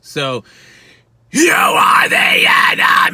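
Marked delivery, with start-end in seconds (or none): 1.3-3.1 very high, loud, drawn-out harsh scream; high-pitched scream. Shown as a demonstration. Coach demonstrating the phrase at high pitch, high scream. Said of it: "I'm gonna do it high pitch".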